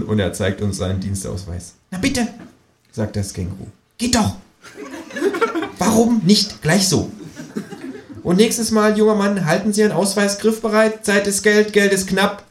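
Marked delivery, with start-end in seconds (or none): 1.9-12.4 much higher cheeky kangaroo voice. Acted. Kangaroo sasses the policeman, high cheeky kangaroo voice. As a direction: sass the officer as the kangaroo.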